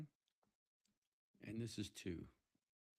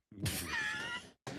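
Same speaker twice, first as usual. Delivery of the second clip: mock wail; 6 dB louder, much slower than usual. Joking about untouched Moxie.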